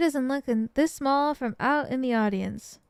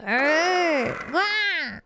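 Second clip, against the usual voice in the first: rolled growl climbing higher; tiger growl; 4 semitones higher than usual. Acted. Tiger appears before Maya and Nick, growling.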